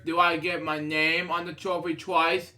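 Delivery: plain reading voice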